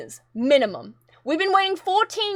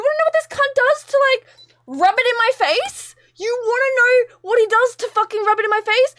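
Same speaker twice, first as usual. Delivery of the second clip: higher, pressing outrage; indignant outrage; 8 semitones higher, 3 dB louder than usual. Real, not acted. Vlogger fuming to viewers that friend rubs it in, outraged. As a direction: demand an answer, fuming.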